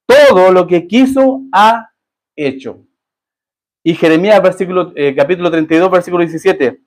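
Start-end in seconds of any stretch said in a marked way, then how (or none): 0.1-2.9 slow solemn reading. Acted. Scripture read slowly.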